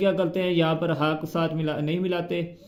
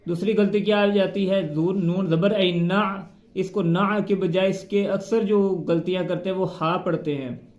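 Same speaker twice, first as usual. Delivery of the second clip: normal talking voice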